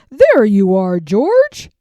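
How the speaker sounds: loud relieved call across the room; relieved cry. Acted. The man with the yellow hat finding George, calling loud and relieved. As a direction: call out at last finding him. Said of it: "he cried".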